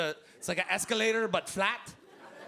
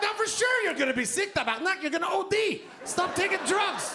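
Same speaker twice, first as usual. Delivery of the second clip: panicked yell; 11 semitones higher than usual. Acted. Comedian warning his girlfriend about the boat, panicked. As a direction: plead in rising alarm. Panicked protest, high loud.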